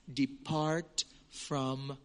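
very slow, soft, drawn-out command; grave command. Acted. A preacher quotes Jesus sending false disciples away, slow and soft. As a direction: draw it out with grave finality.

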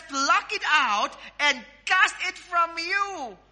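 high, slow preaching cry; emphatic scripture command. Acted. Acting out Christ's command.

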